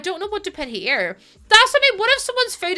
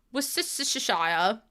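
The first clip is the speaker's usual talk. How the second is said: ordinary delivery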